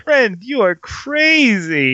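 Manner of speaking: slow high mock dub. Repeating the dub line.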